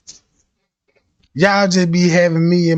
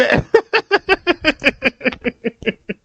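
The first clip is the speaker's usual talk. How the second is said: high breathless cackle; can't stop laughing; long laugh; 6 semitones higher than usual. Real, not acted. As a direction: lose it completely, laughing. Breaks up mid-sentence.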